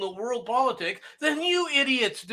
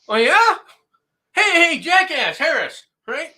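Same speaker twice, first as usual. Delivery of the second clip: mocking taunt; 3 semitones higher, 6 dB louder than usual. Real, not acted. Taunting an absent politician.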